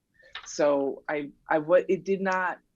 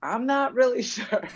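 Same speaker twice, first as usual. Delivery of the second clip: higher, sheepish, a laugh breaking in mid-line; laughing answer; 8 semitones higher than usual. Retold or imitated. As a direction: shrug it off with an embarrassed laugh. Imitating Duncan's sheepish answer.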